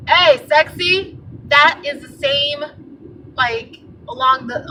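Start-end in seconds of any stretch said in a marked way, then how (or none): 0.1-1.2 slow, louder, slimy hey sexy; mock catcaller. Explaining street harassment to viewers, slow mock catcall.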